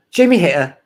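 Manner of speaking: loud mock British "Jamie Hayter"; imitating British accent. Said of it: "she's like".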